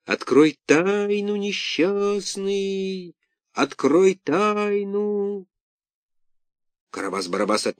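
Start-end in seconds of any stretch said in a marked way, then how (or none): 0.0-5.5 high, slow eerie wail; eldritch horror. A narrator's reading. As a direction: wail it like a ghost. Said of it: "завывающим голосом проговорил из глубины".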